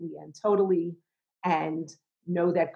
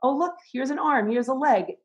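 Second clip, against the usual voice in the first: much higher, delighted discovery; delighted doctor; 7 semitones higher, 3 dB louder than usual. Retold or imitated. Doctor on the sonogram, showing the heartbeat, much higher and delighted. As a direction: point out each part with glee. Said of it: "he goes".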